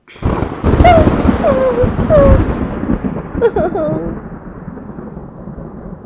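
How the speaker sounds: long high loud crying wail; sustained high baby cry. Acted. Baby cries at the storm.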